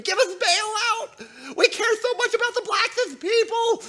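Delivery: high, whimpering mock sob, half laughing; a sniveling parody of someone begging; mock whiny liberal. Mocking opponents with a whiny voice.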